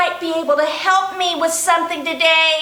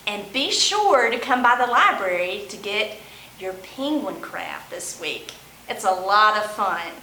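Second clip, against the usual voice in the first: storytime sign-off; 6 semitones lower than usual. A narrator's reading. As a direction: wrap up gently for the kids. Librarian closing storytime.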